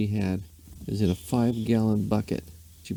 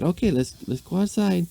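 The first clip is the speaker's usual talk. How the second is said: lady's voice; 10 semitones higher than usual. A narrator's reading. Lady's voice in story.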